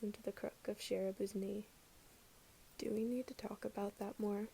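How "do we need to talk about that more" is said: whispered, soft and hesitant; soft mumble. A narrator's reading. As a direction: barely voice it, unsure. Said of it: "Kyotani mumbles after a while".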